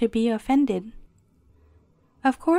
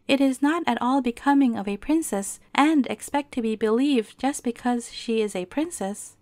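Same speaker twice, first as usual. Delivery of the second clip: usual speaking voice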